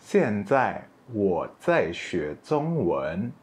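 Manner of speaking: very slow, careful Mandarin; slow classroom demo; slow model sentence. Shown as a demonstration. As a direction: spell it out slowly for learners. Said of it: "Jadi seperti ini bilangnya".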